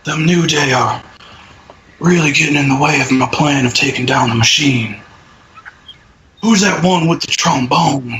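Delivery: half-hearted villain promo voice, barely changed; god awful Bray Wyatt impression. Podcast host doing a deliberately awful Bray Wyatt impression. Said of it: "That was my god awful Wyatt impression".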